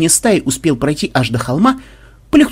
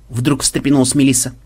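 ordinary delivery

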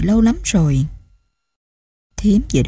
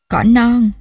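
higher, emotional call with a tremor; trembling voice; 4 semitones higher, 4 dB louder than usual. A narrator's reading. Grandmother calls to the child Cỏ non, trembling voice. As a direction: call out tenderly, voice trembling a little. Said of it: "rung giọng nói".

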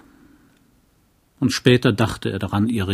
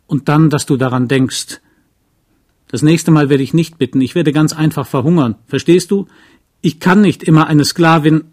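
loud, heated, pitched-up protest; defiant outburst; 4 semitones higher, 6 dB louder than usual. Acted. Wife confronting her husband about money, loudly defiant. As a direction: she spits out every grievance, raising her voice. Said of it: "Ihr Trotz".

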